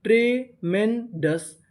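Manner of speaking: ट्रेमेंडस slowly in three separate parts; syllable by syllable. Shown as a demonstration. Teacher builds a word for learners, ट्रेमेंडस slowly broken up. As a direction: pause between the pieces of ट्रेमेंडस. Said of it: "तो meaning क्या बनी?".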